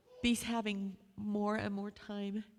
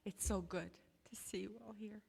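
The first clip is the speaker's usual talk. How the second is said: ordinary delivery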